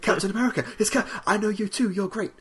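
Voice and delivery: high, gushing fan voice; high starstruck Paul Rudd fanboy. Retold or imitated. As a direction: gush like meeting your hero. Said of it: "won't stop shaking his hand".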